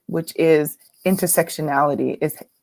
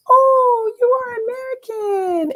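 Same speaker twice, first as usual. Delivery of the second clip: very high-pitched mock delight; suddenly changed voice. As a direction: imitate him gushing in a put-on high voice. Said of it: "And his voice changed".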